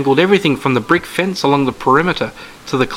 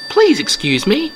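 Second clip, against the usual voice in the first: put-on old lady voice; 10 semitones higher than usual.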